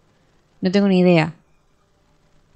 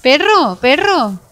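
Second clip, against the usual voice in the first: loud, high, swooping cries; indignant shout; 7 semitones higher, 7 dB louder, slower than usual. Real, not acted. Player begs the enemy to let her flee, shouting. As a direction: yell at the enemy to back off.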